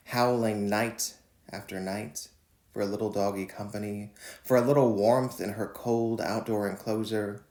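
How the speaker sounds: measured, deliberate poem reading; poetry reading. Acted. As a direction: read each line like a spoken poem. Reads his poem Howl.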